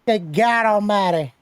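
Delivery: high announcer call, lightly voiced; a hint of a wrestling announcer; Jim Ross. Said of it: "J R described it as".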